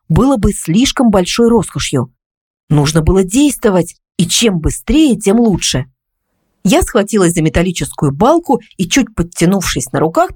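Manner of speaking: plain reading voice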